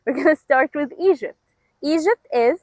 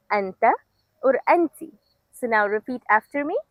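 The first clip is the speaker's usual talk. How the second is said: normal talking voice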